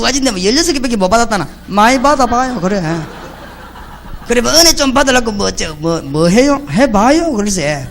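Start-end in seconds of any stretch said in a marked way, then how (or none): none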